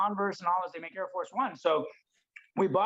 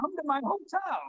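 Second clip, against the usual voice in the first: much higher, in-your-face invitation; flamboyant, excited; 9 semitones higher than usual. Retold or imitated. Inviting the young man to his hotel, flamboyant and excited. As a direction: play it flamboyant and pushy. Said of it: "just in his face, excited".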